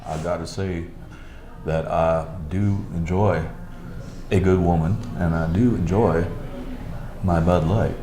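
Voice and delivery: Sam Elliott impression. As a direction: lean back and talk low and easy like the old cowboy. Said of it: "I think he does Sam Elliott gr-, really well".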